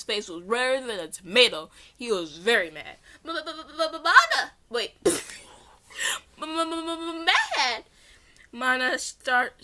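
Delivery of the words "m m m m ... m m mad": higher, laughing stutter. Acted. Mana stutters at the angry man, giggling. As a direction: stammer the start, giggling. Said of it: "stuttered out".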